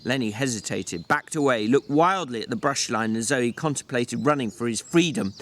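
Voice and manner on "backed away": ordinary delivery, nothing marked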